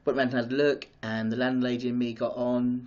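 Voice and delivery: ordinary delivery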